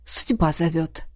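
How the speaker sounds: bow out with false sorrow; feigned regret. A narrator's reading. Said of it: "с притворным сожалением". (с наигранной печалью, пониже)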